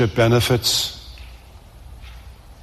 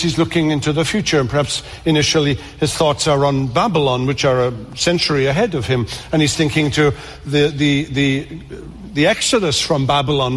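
usual speaking voice

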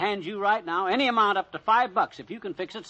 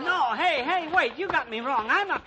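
panicked pleading; 9 semitones higher, slower than usual. Acted. Pleading while held.

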